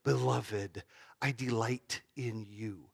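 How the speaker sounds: soft, slow and loving; tender voice of God. Retold or imitated. Preacher voicing God's word at the baptism, soft and tender. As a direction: speak it like a gentle blessing. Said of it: "he hears this word".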